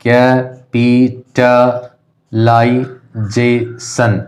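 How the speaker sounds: Capitalisation very slow as ca, pi, ta, lai, je, shan; teacher dividing capitalisation into six drawn-out beats; syllable by syllable. Shown as a demonstration. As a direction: sound out capitalisation with a gap after every syllable. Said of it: "distinguish करके देख लेते हैं".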